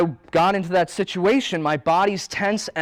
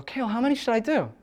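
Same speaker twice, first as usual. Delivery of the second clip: asking client; 9 semitones higher, faster than usual. Retold or imitated. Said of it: "People go".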